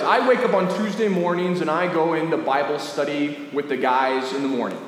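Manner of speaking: ordinary talk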